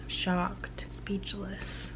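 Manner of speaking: hushed, breathless whisper of shock. Real, not acted. Vlogger whispering her shock at the book. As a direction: breathe the words out, stunned.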